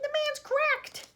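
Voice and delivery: very high, loud, drawn-out; indignant outburst. A narrator's reading. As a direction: cry it out in outraged alarm. Grandma declares Wonka is mad.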